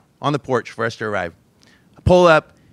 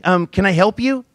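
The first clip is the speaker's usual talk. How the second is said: higher, louder, lightly voiced child; eager five-year-old hello, lightly imitated; little girl greeting; 8 semitones higher, 4 dB louder than usual. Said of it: "she's like".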